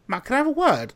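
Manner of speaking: lightly voiced boss, falsely sweet; summoning the hungover worker, mock sweet; ominous boss summons. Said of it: "she ... went".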